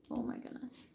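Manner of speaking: breathless unvoiced whisper. Real, not acted. Whispered reaction to the perfume blurb. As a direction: gasp it under the breath.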